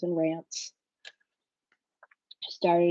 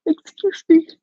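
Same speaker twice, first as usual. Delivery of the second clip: yawning; 9 semitones higher, 9 dB louder than usual. Real, not acted. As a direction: excuse me through a yawn. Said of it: "I did not mean to yawn on you".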